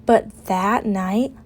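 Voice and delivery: ordinary delivery, nothing marked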